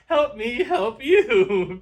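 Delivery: very slow, high, loud plea; comic plea. Acted. Joking with her guest, slow mock plea. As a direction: stretch out the plea playfully. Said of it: "comic plea".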